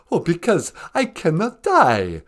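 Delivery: loud, melodramatic count with mock sobs; overacted crying count; hammed-up vampire lament. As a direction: wail about the curse like a sobbing vampire. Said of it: "Three cries".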